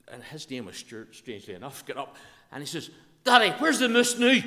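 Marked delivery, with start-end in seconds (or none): 3.2-4.4 put-on high, very loud child's shout in broad Ulster Scots; loud, high-pitched yell to Daddy; small boy calling out. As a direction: pipe up like a wee boy across a silent cinema. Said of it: "in the silence".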